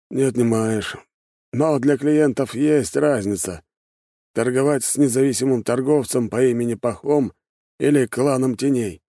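put-on high-pitched old man reasoning it out; old shopkeeper, reluctant. Old shopkeeper explains his doubts to the clan head, reluctant. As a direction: argue the point in a high shopkeeper's voice.

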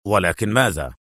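low, firm demand; deep, curt male question; quick and firm. A narrator's reading. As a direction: press her for the rest. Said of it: "سألها في سرعة وحزم".

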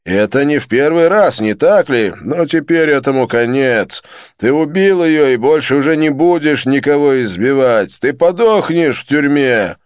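loud contemptuous tirade; angry scolding. A narrator's reading. Andhkara berates Joseph for beating his wife, contemptuous. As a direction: berate the wife-beater with scorn.

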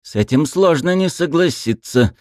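high, cold mocking agreement; a second vampire, amused and cold; sly vampire voice. A narrator's reading. Another vampire speaks.

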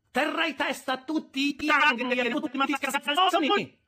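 high, loud, heated retort; losing temper. A narrator's reading. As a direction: start flying off the handle. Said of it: "incominciando a perdere le staffe".

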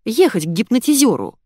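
forceful order; barking. A narrator's reading. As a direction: bark out a brusque instruction. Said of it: "рявкнула подруга".

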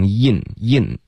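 "in" as a slow, tight yin; wrong high vowel. Retold or imitated. Teacher warns against the mistake, wrong high-vowel yin. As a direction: say "in" with the tongue root too high, like Mandarin yin. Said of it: "别发成in".

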